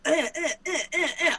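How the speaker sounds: string of high, staccato "eh" noises; imitated Vegas racket. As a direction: mimic nonstop frantic overload with repeated yelps.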